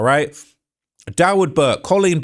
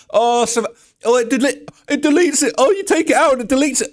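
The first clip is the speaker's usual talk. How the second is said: mocking voice; 9 semitones higher than usual. Mocking the company's excuse.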